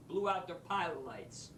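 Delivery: ordinary talk, nothing marked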